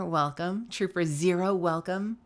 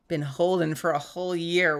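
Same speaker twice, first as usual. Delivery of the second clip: ordinary talk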